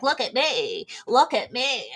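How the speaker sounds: bug's head begging for attention, swooping little cry; show-off bug voice. Pretend. Bug with big wings showing off, mock attention-seeking. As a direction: play an attention-seeking bug, repeating the same falling call. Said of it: "And then their head's like".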